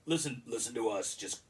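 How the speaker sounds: gruff dwarf line, lightly voiced; mock the grumbling; a hint of gruff dwarf; quoting Josh Gad. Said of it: "there's a line that Josh Gad says".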